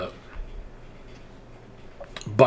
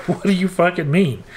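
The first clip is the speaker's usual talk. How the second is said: imagined Biden outburst; 6 semitones higher than usual. Retold or imitated. Vlogger voicing what Biden should have fired back, incredulous. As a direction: voice jumps high in mock disbelief. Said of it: "And then Biden's just like".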